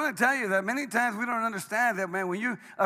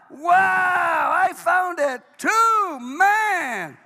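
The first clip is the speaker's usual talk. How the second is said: high, loud and drawn-out shout of joy; joyful shout; 10 semitones higher, 11 dB louder, much slower than usual. Retold or imitated. Saved man calling out to everyone, joyful shout. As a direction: shout it from the mountaintop. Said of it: "I shouted it out from the mountain".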